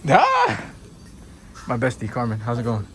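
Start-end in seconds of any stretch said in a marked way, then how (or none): 0.1-0.6 high happy shriek of greeting; excited shriek. Real, not acted. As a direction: squeal at seeing a friend. Spots friend Carmen.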